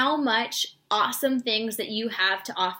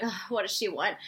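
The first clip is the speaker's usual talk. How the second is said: annoyed; 2 semitones lower, 6 dB quieter than usual. Retold or imitated. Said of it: "they're just like".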